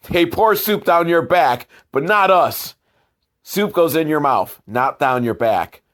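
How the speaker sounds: normal talking voice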